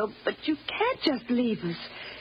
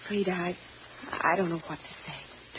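low, soft, halting apology; embarrassed apology; 6 semitones lower, 6 dB quieter than usual. Acted. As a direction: falter softly, lost for words. Embarrassed apology after son leaves.

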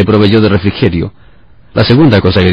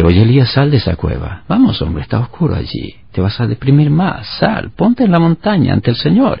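softer, higher coaxing voice; gentle, not scolding; 5 semitones higher, 4 dB quieter than usual. Retold or imitated. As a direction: coax him gently out of the cave. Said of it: "Dios no lo regaña". (Dios anima a Elías con suavidad, sin regañar)

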